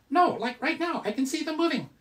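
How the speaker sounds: high squeaky puppet voice; squeaky ventriloquist dummy. Pretend. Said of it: "Coconut Monkey".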